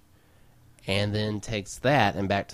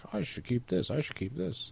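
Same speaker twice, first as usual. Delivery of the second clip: mock inner voice; 8 semitones higher, 6 dB quieter than usual. Mocking own hoarding thoughts.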